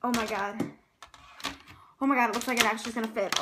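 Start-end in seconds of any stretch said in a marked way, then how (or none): none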